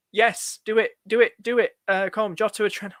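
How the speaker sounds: ordinary delivery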